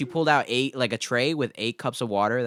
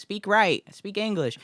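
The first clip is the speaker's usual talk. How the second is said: higher-pitched retold scolding; imitated mocking customer; 9 semitones higher than usual. Customer mocking a teenage worker's accent, high mocking complaint. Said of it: "like, making fun of my accent pretty much".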